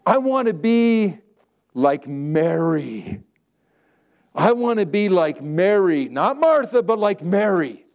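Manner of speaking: I want to be like Mary, drawn out slow; slow emphatic longing. Acted. Pastor declaring to the flock, slow and emphatic. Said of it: "stretched emphatic declaration".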